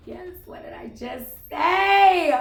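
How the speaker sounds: high, loud, drawn-out exclamation; triumphant outburst. Real, not acted. Reader sees the card confirm their words, triumphant shout. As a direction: crow it out, stretching the words.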